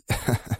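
short, soft scornful chuckle; quiet sarcastic snicker; mocking laugh. A narrator's reading. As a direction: laugh derisively. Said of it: "ثم قهقه ضاحكا في سخرية".

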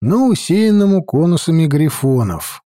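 usual speaking voice

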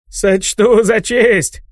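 high, very slow, grand satyr voice; proud satyr. Acted. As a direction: declare it high and drawn out. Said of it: "топнул копытом сатир".

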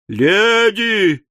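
high, urgent call for attention; desperate shout. A narrator's reading. Pickwick shouting to the panicking ladies, desperate. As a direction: cry out over the commotion. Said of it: "закричал мистер Пиквик".

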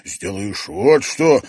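flustered. Acted. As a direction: tumble out the words wildly. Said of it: "сбивчиво говорил журналист".

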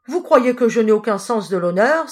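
indignant cry. A narrator's reading. Thief flares up at the old knight's sermon, indignant cry. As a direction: cry out, wounded in pride. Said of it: "s'écria-t-il".

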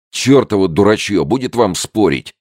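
loud, high exasperated shout. A narrator's reading. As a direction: yell to stop the bickering. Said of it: "закричал Смиди". (громкий раздражённый крик)

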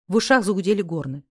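usual speaking voice

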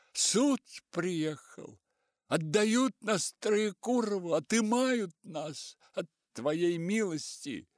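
put-on high, slow, breathless old servant; panting old man pleading; breathless old person. A narrator's reading. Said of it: "задыхаясь".

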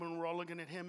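normal talking voice

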